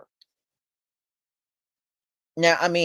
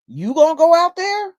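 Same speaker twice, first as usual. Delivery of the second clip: higher, louder indignant outburst; indignant, much higher and louder; outraged; 11 semitones higher, 6 dB louder than usual. Real, not acted. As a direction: rise up in disbelief and push it out. Outraged at widow.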